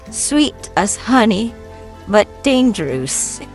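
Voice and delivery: ordinary delivery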